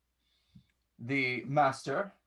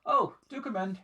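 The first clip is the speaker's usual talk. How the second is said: higher, airy gentleman's welcome; a lord's polite greeting from his desk; lightly hammed-up aristocrat voice; genteel old master; 7 semitones higher than usual. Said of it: "And he goes".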